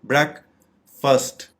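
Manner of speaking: "ब्रेकफास्ट" split slowly, BREK, pause, FUST; syllable by syllable. Shown as a demonstration. Teacher showing two syllables, "ब्रेकफास्ट" as BREK ... FUST. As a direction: break "ब्रेकफास्ट" into two slow short-vowel syllables. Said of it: "इसके टू syllables हैं".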